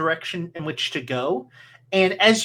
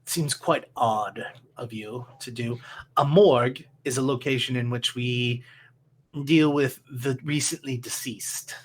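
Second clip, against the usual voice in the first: quiet, low deadpan undertaker; low, dry mortician; 4 semitones lower, 4 dB quieter than usual. Acted. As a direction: speak low and flat, unimpressed.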